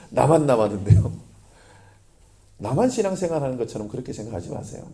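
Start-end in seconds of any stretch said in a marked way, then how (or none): 0.1-1.3 laughing repeat. Retold or imitated. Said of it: "엘리야가 늘 하는 말이".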